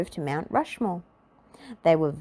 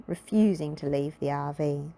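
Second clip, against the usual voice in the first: plain reading voice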